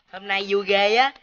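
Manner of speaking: ordinary talk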